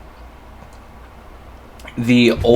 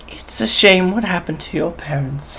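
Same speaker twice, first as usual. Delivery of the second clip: put-on old woman voice; 6 semitones higher, 5 dB quieter than usual. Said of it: "two gold coins and says".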